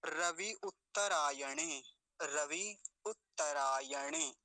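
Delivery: slow, vowels drawn out; careful syllable-by-syllable reading; model pronunciation. Shown as a demonstration. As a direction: recite it deliberately for learners. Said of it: "अब आगे देखिए".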